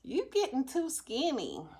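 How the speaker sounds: high, slow mocking voice. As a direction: drawl it out in a high mock tone. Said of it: "they were like".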